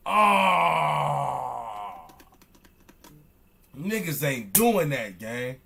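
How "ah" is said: pained outcry. Real, not acted. Outcry after disbelief.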